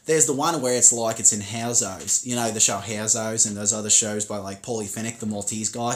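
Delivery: Aussie accent, Howzo's style; demonstrating a suburban Australian twang; broad Australian accent. Shown as a demonstration. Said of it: "highly impressed with your Australian accent".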